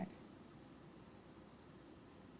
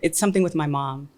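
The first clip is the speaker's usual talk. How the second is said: usual speaking voice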